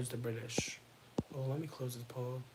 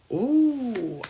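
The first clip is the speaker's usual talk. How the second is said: delighted ooh; 9 semitones higher, 13 dB louder than usual. Real, not acted. Delighted at messy drama.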